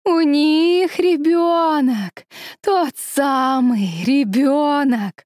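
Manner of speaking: high, very slow monster cry; shrieking vine women. Acted. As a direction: wail it like a hungry creature. Vine women raise the alarm. (протяжный вопль нечисти)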